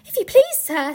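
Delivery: high, loud start falling back; Missus Beaver. Acted. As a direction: pipe up as a storybook character asking politely. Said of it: "said Missus Beaver".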